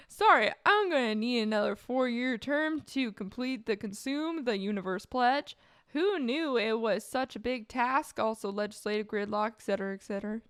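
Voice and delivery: emphatic, fluent mock apology; performed second take. Streamer redoes the politician's excuse for another term, performed. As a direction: deliver the excuse with lively swings, then trail into a list. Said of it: "let me try that again".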